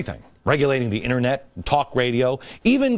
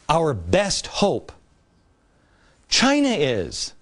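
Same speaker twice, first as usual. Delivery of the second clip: slow, heavy repeat of China is; drawn out in disbelief; slow sarcastic repeat; much slower than usual. Shown as a demonstration. Repeating the scientist's claim, slow and sarcastic.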